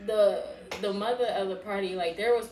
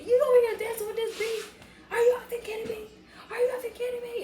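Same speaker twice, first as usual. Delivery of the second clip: very high-pitched, incredulous outburst; retold cursing rant; 13 semitones higher, 4 dB louder, faster than usual. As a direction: squeal each accusation at him. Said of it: "Like, cursing".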